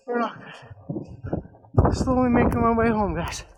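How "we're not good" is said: slow strained whisper; breathless whisper. Real, not acted. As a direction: gasp it out, winded.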